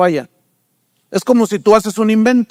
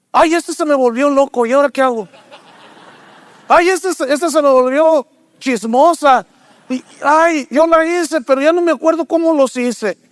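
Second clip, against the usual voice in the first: higher, fretting mock complaint; mock-confused God; 5 semitones higher than usual. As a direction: pretend God grumbling about his creation as a joke. Preacher voices God joking. (Dios fingiendo estar confundido, en broma, voz más aguda)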